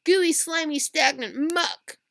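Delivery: loud, high, disgusted yell that slows at the end. Acted. Alan yells in disgust at the worm dug from the muck, high and loud. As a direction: shout out the disgust, dragging the last words. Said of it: "yelled Alan".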